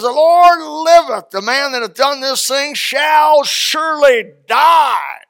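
slow, loud, high roaring oath; furious shout. Retold or imitated. Angry king pronouncing judgment before his court. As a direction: thunder the death sentence. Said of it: "he said it loudly".

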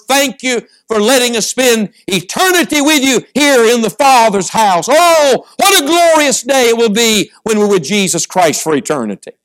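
building to a high, exultant peak; impassioned preaching. Real, not acted. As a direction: swell with gratitude into a ringing cry of praise.

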